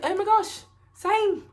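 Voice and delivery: high-pitched mock English accent. Traveller replying to the woman from the UK, mock English accent. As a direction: echo her in an exaggerated, squeaky-high English accent. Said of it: "English accent".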